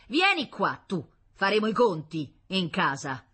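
loud, high opening command; stern father. Acted. Father catches his fleeing daughter, sternly threatening. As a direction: call the child back with a threat.

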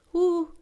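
high, loud "uh!"; shouted whoop. A narrator's reading. Mariella arrives with two or three men, shouting a whoop. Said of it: "Mariella, gridando".

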